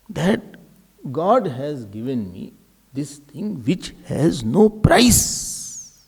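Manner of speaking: ordinary talk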